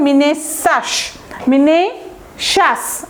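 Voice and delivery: মিনেশাস drawn out, "mi-ne... shas"; demonstrated pronunciation. Shown as a demonstration. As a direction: slowly split মিনেশাস before its "sh" sound. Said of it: "এইরকম একটা উচ্চারণ হবে".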